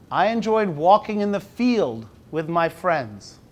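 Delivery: slow, measured picture-book reading; reading to children. Acted. Teacher reading the book aloud slowly to the class. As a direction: read it slowly to five-year-olds.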